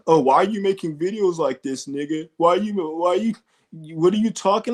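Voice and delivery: hammed-up hillbilly critic; mocking whiny hater. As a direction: ridicule the critic by parroting him. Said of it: "he's all t-, trying to tell me".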